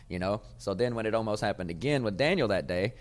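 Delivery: normal talking voice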